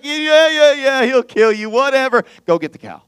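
loud, breezy brush-off that drops down at the end; dismissive God. Retold or imitated. Preacher voicing God brushing off Samuel, breezy and dismissive. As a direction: wave off the worry like it's nothing.

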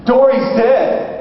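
high, slow mock alarm; frantic wife. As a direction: imitate a panicked wife announcing a disaster. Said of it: "And she's frantic".

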